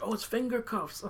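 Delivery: lightly put-on Jay, squeaky and silly; mock Jay voice. Quoting Jay in a high mock voice. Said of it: "Jay's like".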